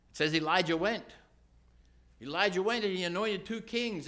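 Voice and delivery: loud, high, driving storytelling; fervent preaching. Acted. Preacher triumphantly recounting Elijah's obedience to God. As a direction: keep pressing through the story.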